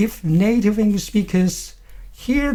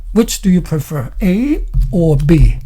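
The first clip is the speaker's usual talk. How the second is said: "A" stretched and rising, "B" falling; choice-question tune; 4 dB louder than usual. Shown as a demonstration. Teacher models choosing A or B, rising "A", falling "B". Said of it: "rising up".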